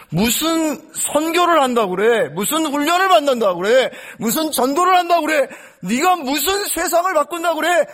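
mock the believer, pitch rising each time; mocking Satan. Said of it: "사탄이 그렇게 얘기해요".

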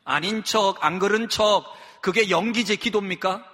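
usual speaking voice

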